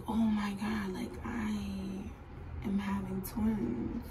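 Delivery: soft, slowing realization; hushed inner thought. Retold or imitated. Patient waiting alone with the news, soft stunned thought. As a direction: let it sink in, barely voiced. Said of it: "And I'm just thinking".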